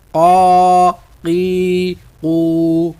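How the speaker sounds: قا قی قو stretched slowly from the throat; drawn-out drill. Shown as a demonstration. Teacher drilling ق slowly from the throat. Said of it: "حلق کے درمیان سے ہی ادا کریں گے".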